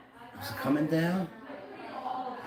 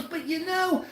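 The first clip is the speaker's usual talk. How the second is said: mocking Doctor V; 11 semitones higher, slower than usual. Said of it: "He goes".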